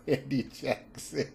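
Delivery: trying not to laugh; giggling. Real, not acted. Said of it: "I can't stop laughing".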